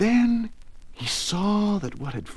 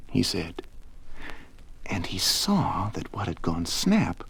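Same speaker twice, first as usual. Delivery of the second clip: plain reading voice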